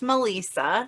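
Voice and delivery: Melissa with a long ee, muh-LEE-suh; long-ee pronunciation. Shown as a demonstration. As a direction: stretch the middle of Melissa into "lee". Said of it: "my name is actually pronounced".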